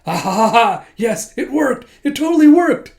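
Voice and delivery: high, loud villainous crowing; hammed-up triumphant glee; gloating triumph. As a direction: laugh and crow over the scheme. Director gloats that scheme worked.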